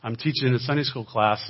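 ordinary talk, nothing marked

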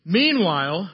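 loud, drawn-out movie narrator. Acted. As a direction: announce a scene change with a big swooping tune. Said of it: "I had this narrator voice".

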